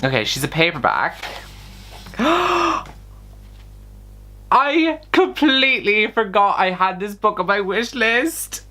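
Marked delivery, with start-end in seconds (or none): 2.2-2.8 high, sharp gasp of surprise; real surprised gasp. Real, not acted. Vlogger opening a gift from her wish list, surprised gasp. 4.5-8.7 high, slow, drawn-out amazement; real happy surprise. Real, not acted. Vlogger unwrapping a book she forgot about, delighted surprise.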